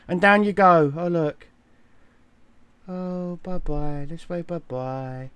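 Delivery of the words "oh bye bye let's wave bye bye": very quiet, drawn-out goodbyes; hushed sing-song farewell, sinking lower; soft mock farewell. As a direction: wave someone off in a tiny fading voice.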